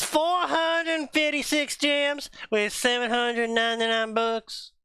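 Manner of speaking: high, slow put-on British accent; mock British voice. Player reading Harry's vault totals in a put-on British voice. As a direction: read the vault numbers like a pompous banker.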